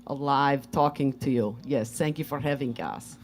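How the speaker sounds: lightly put-on Russian accent. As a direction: speak as Svetlana with a Russian accent. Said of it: "Tonight, Svetlana".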